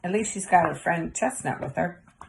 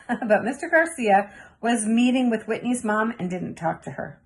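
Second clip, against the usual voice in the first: usual speaking voice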